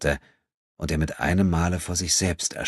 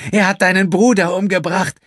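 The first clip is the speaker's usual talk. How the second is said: hateful woman's voice; 10 dB louder than usual. Acted. Furious woman accuses André.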